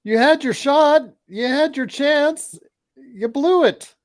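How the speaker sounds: high-pitched, loud taunting holler; yelled taunt. Real, not acted. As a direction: crow it at them, voice shooting way up. Yelling taunts at the game.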